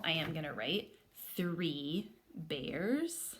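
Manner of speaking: ordinary talk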